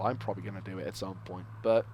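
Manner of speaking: ordinary talk, nothing marked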